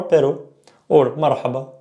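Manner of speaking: "pero" and "marhaba" with single tapped R; soft R. Shown as a demonstration. Teacher gives soft R examples, light tap. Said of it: "So soft R".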